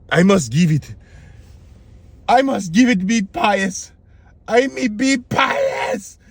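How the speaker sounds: hammed-up pious declaration, climbing high; halting, high-pitched theatrical vow; devout king Bali. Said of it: "And then he's like".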